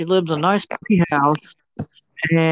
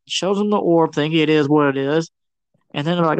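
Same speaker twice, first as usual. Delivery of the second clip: ordinary delivery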